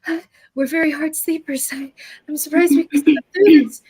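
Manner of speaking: lightly voiced flustered wife; grateful woman. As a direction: gush thanks, then remember the kids. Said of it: "she's like".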